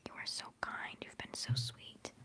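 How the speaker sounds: usual speaking voice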